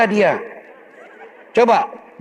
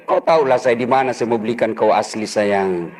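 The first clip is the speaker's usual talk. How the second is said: low sweet-talk; 10 semitones lower than usual. Acted. Husband sweet-talking wife.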